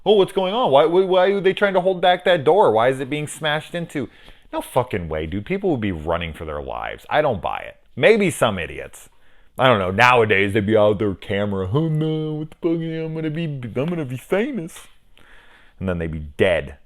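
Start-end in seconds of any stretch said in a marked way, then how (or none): 0.0-4.1 high, mock clueless bystander; dumb bystander. Mocking the curious crowd by the door, a high clueless voice. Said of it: "anyone who's like". 11.7-14.9 high, giddy wannabe voice; mock fame-seeking idiot. Mocking a bystander filming for fame, a high giddy voice.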